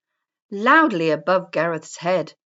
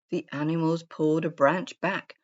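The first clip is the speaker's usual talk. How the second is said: ordinary delivery, nothing marked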